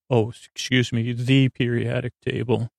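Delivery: usual speaking voice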